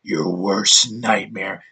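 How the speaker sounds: low, slow menacing growl; slow deep mock tough-guy threat; Rambo growl. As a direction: drop low and drag it out like Rambo. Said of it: "Rambo's like".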